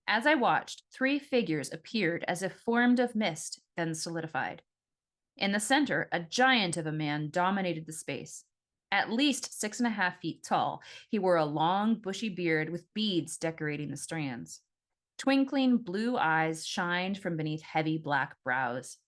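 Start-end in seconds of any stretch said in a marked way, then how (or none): none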